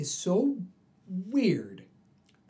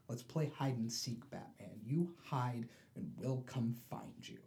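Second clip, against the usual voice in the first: creepy quiet Joker; 3 semitones lower, 9 dB quieter than usual. Retold or imitated. Joker sets hide-and-seek ultimatum.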